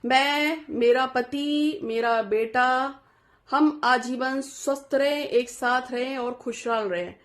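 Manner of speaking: slow, deliberate family blessing; slow prayer wish. Shown as a demonstration. Devotee showing viewers how to pray, said slowly. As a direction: draw each name out slowly. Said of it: "अगर आप शादीशुदा हैं".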